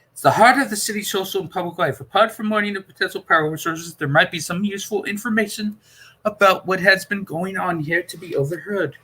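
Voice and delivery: the Doctor explaining calmly, lightly voiced. A narrator's reading. Doctor's voice held in dialogue.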